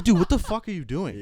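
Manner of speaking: loud, high mock yell; exasperated yell. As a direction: shout at the friend you searched for. Finds buddy eating tacos.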